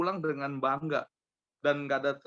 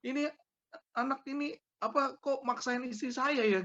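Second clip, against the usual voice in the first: raised pitch, indignant re-enactment; retold angry complaint; 12 semitones higher than usual. Husband complaining to the manager about the teller, indignant. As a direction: complain hotly, acting out the grievance. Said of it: "wah saya emosi gitu loh".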